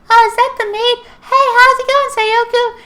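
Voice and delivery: very high falsetto greeting; imitated sweet girl. Nunnally to the maid at the door, falsetto friendly greeting. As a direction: chirp a hello in put-on girlish pitch.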